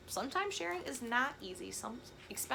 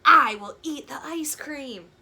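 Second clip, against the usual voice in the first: much louder, slow decisive vow; loud declaration; 3 semitones higher, 14 dB louder, slower than usual. Acted. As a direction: announce it greedily. Gerald's sneaky decision.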